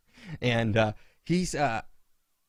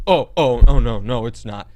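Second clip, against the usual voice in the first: flustered passenger; 4 semitones lower, 9 dB louder than usual. Retold or imitated. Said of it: "He goes".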